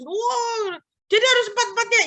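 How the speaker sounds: high mock wail; imitated crying. Niece protests to uncle about four rosary mysteries, mock-tearful. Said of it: "sambil nangis".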